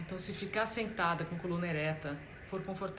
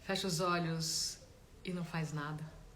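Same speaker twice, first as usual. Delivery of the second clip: guided meditation; 7 dB quieter than usual. Real, not acted. Meditation teacher guiding listeners to notice body and breath, calm guided-meditation voice. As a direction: gently guide them inward, leaving space between phrases.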